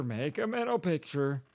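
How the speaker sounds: mock creep repeating it under his breath; hushed creepy murmur. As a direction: mutter it to yourself like a creep. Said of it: "I wouldn't be sitting there going".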